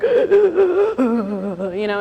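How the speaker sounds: imitating a child's crying gasp; hammed-up teary gasp; mock sobbing gasp. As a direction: fake sob of a frustrated kid. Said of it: "she would be like".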